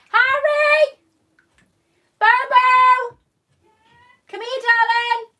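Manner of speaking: loud, high calls across the house; shouting up the stairs for her son mid-livestream; yelling for her son. Real, not acted. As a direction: call him down at full volume, drawn out. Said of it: "Stop yelling, [name]!".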